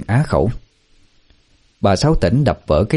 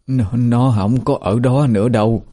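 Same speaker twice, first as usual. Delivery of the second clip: higher, stammering plaintive voice; tearful whimper; 4 semitones higher than usual. A narrator's reading. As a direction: whimper it, close to tears. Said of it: "Bà Sáu miếu máu". (giọng mếu máo, ấp úng)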